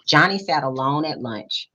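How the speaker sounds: plain reading voice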